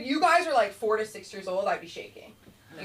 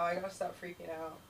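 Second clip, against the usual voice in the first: ordinary delivery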